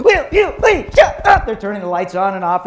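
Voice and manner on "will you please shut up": imitated yell, much higher and louder, slow; screaming teacher. Teacher inside the classroom screaming at kids, hammed-up yell. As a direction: bellow it at an unruly class. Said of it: "they're screaming at the kids".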